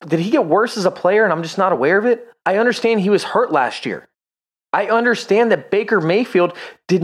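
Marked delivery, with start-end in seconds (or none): none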